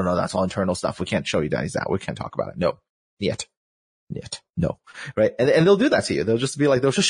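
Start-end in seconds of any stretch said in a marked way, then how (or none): 0.0-4.8 mock stonewalling. Voicing developers refusing to share.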